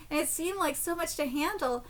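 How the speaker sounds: ordinary delivery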